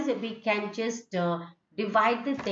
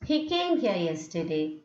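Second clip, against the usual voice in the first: He came here yesterday said low and falling; a settled statement, tune dropping; 3 semitones lower than usual. Shown as a demonstration. Teacher contrasting the statement tune with the question. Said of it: "is a statement".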